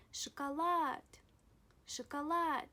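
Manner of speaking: "шоколад" with the last A long, high and loud; stress on final A. Shown as a demonstration. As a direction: lean hard on "лад" in "шоколад". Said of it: "the stress falls on A".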